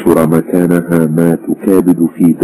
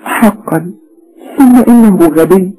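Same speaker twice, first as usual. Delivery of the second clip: tearful. A narrator's reading. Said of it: "ومن عينها تتدفق العبرات".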